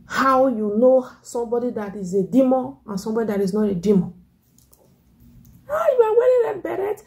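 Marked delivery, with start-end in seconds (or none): 5.7-7.0 high, fast, wailing cry of the critic; hammed-up shrill wail; mock shriek. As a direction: shriek it like a scandalized busybody. Mimicking a critic, crying out.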